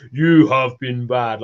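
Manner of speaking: slow booming voice from above; god voice. Retold or imitated. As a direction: scold like a biblical god. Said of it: "like a god voice that comes and talks over you".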